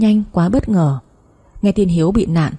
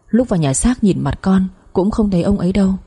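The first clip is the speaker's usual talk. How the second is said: usual speaking voice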